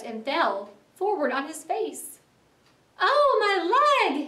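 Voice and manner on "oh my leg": high, loud, drawn-out wail; pained cry. A narrator's reading. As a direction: cry out in pain over a hurt leg. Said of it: "he cried".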